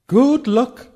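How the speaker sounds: neighed farewell. Acted. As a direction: call it out like a horse whinnying. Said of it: "he neighed".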